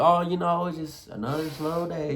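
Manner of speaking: mock a downbeat small-talker; weary complaint fading away; negative comment. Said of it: "the negative comments".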